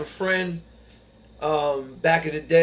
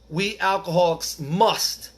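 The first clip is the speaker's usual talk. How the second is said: slow, weighty "must" with the line drawn out; stress on must; 6 semitones higher, 3 dB louder, slower than usual. Acted. Telling fellow alcoholics the key word, slow stress on "must". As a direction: lean hard on "must" like it's underlined. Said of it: "it's a huge word, circle, underline".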